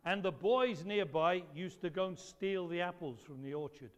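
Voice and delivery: usual speaking voice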